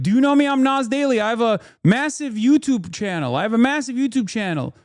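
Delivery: high-pitched, self-important imitation; mock yelling. YouTuber boasting to someone who ignores him, mock yelling. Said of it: "when he approached me, yelling".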